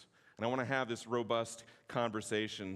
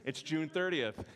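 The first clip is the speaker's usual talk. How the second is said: ordinary delivery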